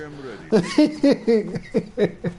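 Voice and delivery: high burst of laughter. Real, not acted. Laughs at own story. (hohes Lachen)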